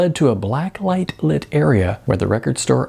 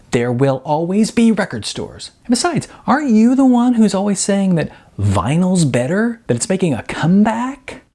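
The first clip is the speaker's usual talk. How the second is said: put-on young guy pushing his point, pitched higher; voicing Sean, teasing and higher; Sean's voice; 11 semitones higher than usual. A narrator's reading.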